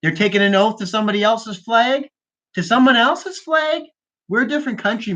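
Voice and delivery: high, protesting question; incredulous outrage. Real, not acted. Podcaster ranting about an oath to another flag, incredulous. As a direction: pitch climbing in disbelief.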